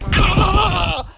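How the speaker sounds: high, loud mock battle scream; mock scream of the angry shirtless hero; imitated scream. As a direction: let out a short hammed-up warrior yell. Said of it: "he just starts going like".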